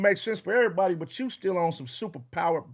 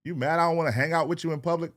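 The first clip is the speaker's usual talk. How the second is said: ordinary talk, nothing marked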